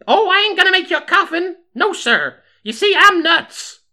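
very high, loud, eccentric character voice; high-pitched and loud, owning up to being nuts; nutty undertaker. Acted. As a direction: play a crazed townswoman, pitched right up high.

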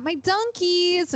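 retold Boston accent. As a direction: proudly quote her with a touch of Boston. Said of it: "in the thickest Boston accent".

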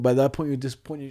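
ordinary delivery

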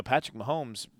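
normal talking voice

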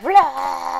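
short loud playful roar of a storm; mock storm roar. Telling of the storm on the river, a mock roar. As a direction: throw out a storm noise.

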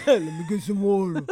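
loud, mock-manly croak of a request; manly morning voice. Repeating the water line as her manly morning voice, hammed up. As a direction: say it like a man just woken up. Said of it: "my voice in the morning is frighteningly manly".

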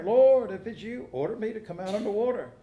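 loud, high, hurried call. Acted. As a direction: call across the water to Jesus. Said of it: "Would you call out like Peter".

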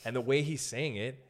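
ordinary talk, nothing marked

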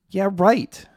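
disbelieving the dropout friends, mocking; sarcastic. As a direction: scoff at the claim. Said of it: "dismissive repeat".

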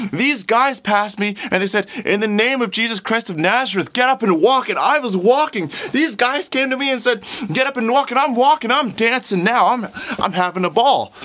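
high, loud, breathless-with-joy testimony; jubilant. Acted. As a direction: tell everyone your miracle, elated. Healed man retells his miracle joyfully.

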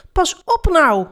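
high-pitched cry of protest; pained shout. A narrator's reading. Felix protests at being hit with a sword, shouting. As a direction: yell out high, as if hurt. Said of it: "schreeuwde Felix".

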